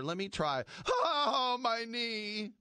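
high yelping mock injury cry; dorky kicker. The dorky kicker chopping wood and hurting himself, in a high mock cry. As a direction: ham up a kicker hurting his knee. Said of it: "his dorky kicker goes over".

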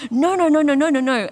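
high, loud burst of indignant refusal; gut anger, re-enacted. Editor reliving first reaction to criticism, gut anger acted out. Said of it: "one of really gut anger".

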